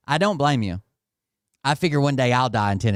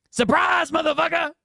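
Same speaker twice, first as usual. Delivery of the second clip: mock shout. Streamer mimicking Bert's threat as a high mock shout. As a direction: ham up his big reveal.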